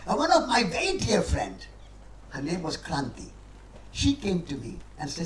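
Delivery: ordinary talk, nothing marked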